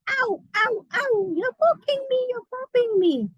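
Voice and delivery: high, pained balloon cries; screaming balloon. Acted. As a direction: cry out like a balloon being popped. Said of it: "the balloon screams".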